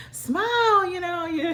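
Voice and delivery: high, bright-pitched sing-song "Smile"; cheery presenter. Retold or imitated. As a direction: chirp it at her like a cheerful presenter. Presenter cheerfully urging her to smile.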